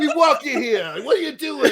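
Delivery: high, loud mock New York accent; hammed-up New Yorker accent through laughter. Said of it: "If they were in New York".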